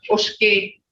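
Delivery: Ushki with a hushing "sh"; Creek tone. Shown as a demonstration. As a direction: say Ushki as "oosh-kee", Creek style. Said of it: "You'll get that tone".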